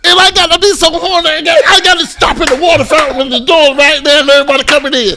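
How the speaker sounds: high, loud, fast disbelief; incredulous rant. Real, not acted. Mocking the rumor to his friend, loud and high. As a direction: ridicule the idea loudly.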